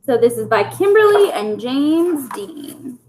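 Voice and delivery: plain reading voice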